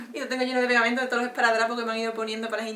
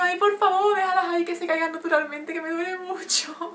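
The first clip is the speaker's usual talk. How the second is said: pleading yelp; 6 semitones higher than usual. Retold or imitated. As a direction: beg the doctor to stop hurting you. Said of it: "Y yo chillando".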